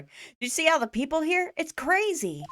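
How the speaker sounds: put-on excited young girl. Maya gushing to Nick about the crowd, high and excited. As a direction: gush like a bubbly teen sidekick.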